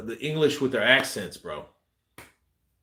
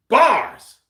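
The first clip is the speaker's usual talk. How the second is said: sudden high, loud shout; exclaimed shout; 12 semitones higher, 9 dB louder than usual. Real, not acted. Streamer hailing a viewer's rhyming sign-off, excited shout. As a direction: call it out at full pitch.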